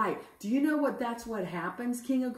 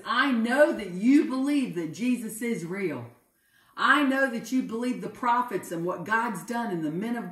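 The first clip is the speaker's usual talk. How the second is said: louder, emphatic preacher's appeal; fervent urging; 2 semitones higher, 5 dB louder than usual. Acted. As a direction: press the king with conviction. Paul presses King Agrippa to believe.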